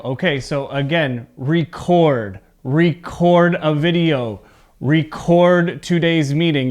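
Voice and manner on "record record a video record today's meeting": record as re-CORD each time, measured and clear; record said ree-CORD, second part stronger; verb stress on second syllable. Shown as a demonstration. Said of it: "Your voice goes up at the second part".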